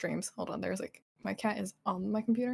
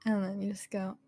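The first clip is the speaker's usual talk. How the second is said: ordinary talk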